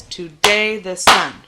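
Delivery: "Today the sun" slow and loud on DAY and SUN; iambic rhythm. Shown as a demonstration. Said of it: "the rhythm of this line".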